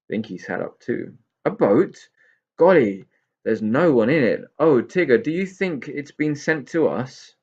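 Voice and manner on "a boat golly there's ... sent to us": higher, louder, breathless wonder; thrilled pixie. Acted. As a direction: gasp at the empty boat.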